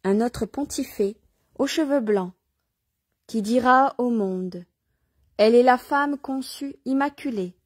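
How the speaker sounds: measured, declaimed reading with phrase-by-phrase pauses; devotional reading aloud. Acted. Reader proclaiming Mary to listeners, devotional reading aloud. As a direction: read it out solemnly, phrase by phrase.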